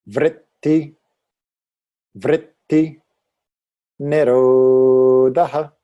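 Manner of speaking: each word sung out slowly twice in Sanskrit, loud. Shown as a demonstration. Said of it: "You can respond to me down here".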